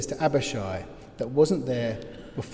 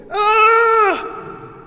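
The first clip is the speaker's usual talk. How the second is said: high, loud re-enacted scream; top-of-the-voice scream; 9 dB louder than usual. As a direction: scream as if waking from a nightmare. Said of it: "I screamed at the top of my voice".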